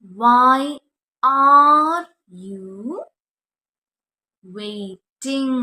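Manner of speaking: loud, slowly drawn-out question; teacher model sentence. Shown as a demonstration. Teacher to Malayalam students, loud and slow model. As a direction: spell it out for learners, stretching each word. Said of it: "teacher models the English question".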